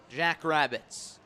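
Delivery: normal talking voice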